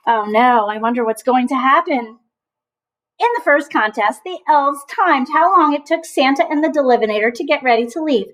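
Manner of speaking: ordinary delivery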